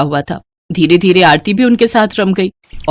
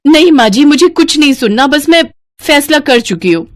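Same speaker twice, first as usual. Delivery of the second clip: daughter-in-law refusing to listen, raised voice; firm refusal; 7 semitones higher than usual. Acted. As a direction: cut the mother-in-law off, decided. Refusing mother-in-law firmly.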